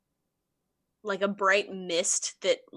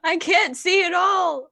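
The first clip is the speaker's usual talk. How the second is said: higher-pitched, loud, emphatic yell; shouted repeat; 10 semitones higher, 8 dB louder than usual. Acted. Blinded character repeating it to a deafened friend, emphatic shout. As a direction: shout it over for someone deafened.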